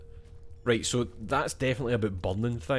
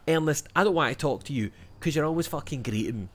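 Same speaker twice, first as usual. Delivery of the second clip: mock telling-off; 5 semitones higher, faster than usual. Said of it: "I wish I could do that to him".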